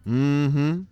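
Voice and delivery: a light hint of women agreeing; affirming church ladies. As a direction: hum along in sassy agreement.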